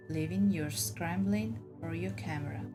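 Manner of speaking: plain reading voice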